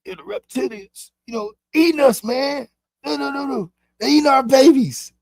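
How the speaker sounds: lightly hammed-up alarm about reptilians; mocking conspiracy talker. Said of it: "we'll be like".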